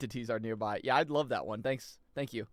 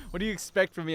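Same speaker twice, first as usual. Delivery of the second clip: normal talking voice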